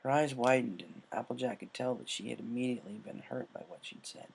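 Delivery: plain reading voice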